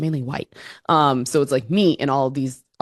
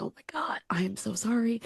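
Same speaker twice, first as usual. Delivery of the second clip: imitated whispered apology, much softer; retold whisper; 4 semitones higher, 11 dB quieter than usual. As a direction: whisper the sorry across to your friend. Whispering apology to drunk friend.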